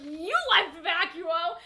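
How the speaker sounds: slow, loud accusation; accusing. Retold or imitated. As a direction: throw it back at him slowly. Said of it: "Scarlet's like".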